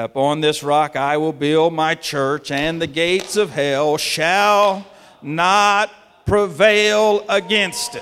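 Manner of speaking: slow, loud preaching of scripture; proclaiming scripture. Acted. Preacher quoting Jesus to the congregation, rising to a shout. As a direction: declaim it to the rafters.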